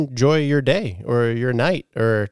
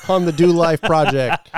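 laughing; 5 semitones higher, 4 dB louder than usual. Real, not acted. Laughing while wrapping up bit.

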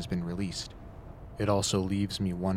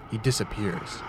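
usual speaking voice

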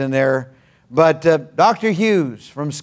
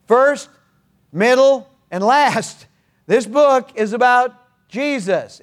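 emphatic preaching; 7 semitones higher than usual. Real, not acted.

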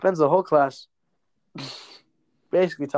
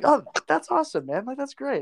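high, gushing praise as the recruiter; hammed-up recruiter enthusiasm; 10 semitones higher than usual. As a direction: play the recruiter beaming with approval. Recruiter voiced, gushing approval.

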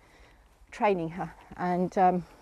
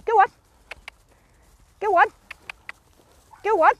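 loud, high call to the horse; 12 semitones higher, 7 dB louder, faster than usual. Real, not acted. Trainer urging her lunging horse, loud high call. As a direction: send the mare forward with a shout.

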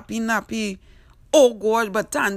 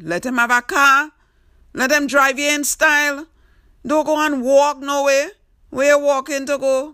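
louder, higher telling-off; mild scolding; 6 semitones higher, 5 dB louder than usual. Real, not acted. Host scolding Tanti for walking to the stand, loudly. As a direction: lecture her like an exasperated aunt.

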